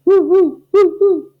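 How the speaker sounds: high loud yelping barks; barking. Acted. Haunted dog barking in the barn, loud barks. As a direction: bark like a spooked dog. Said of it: "who barked".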